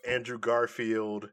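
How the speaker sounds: ordinary talk, nothing marked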